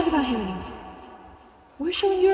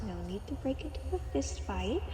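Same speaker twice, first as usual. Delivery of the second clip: usual speaking voice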